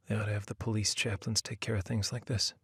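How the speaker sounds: muttered. A narrator's reading. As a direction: mutter it under your breath. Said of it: "he muttered".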